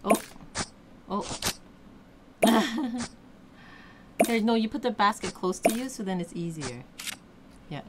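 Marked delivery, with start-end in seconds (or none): 2.4-3.1 short, higher laugh; brief burst of giggling; amused chuckle. Real, not acted. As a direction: let out a little laugh. Parent amused by the game.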